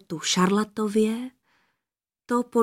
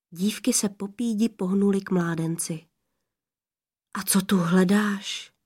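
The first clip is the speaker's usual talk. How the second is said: ordinary delivery, nothing marked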